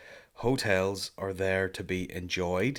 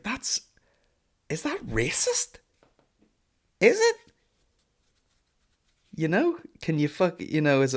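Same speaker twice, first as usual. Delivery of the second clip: much higher, squeaky mock disbelief rising to a loud yelp; mock shock; 10 semitones higher, 5 dB louder than usual. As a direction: play scandalised surprise, climbing up to a squeal. Shocked reaction to stew in pint.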